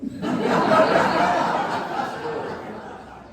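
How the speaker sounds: a big real laugh; hearty laugh. Real, not acted. Teller cracking up at his own story, laughing. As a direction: laugh out loud at the memory.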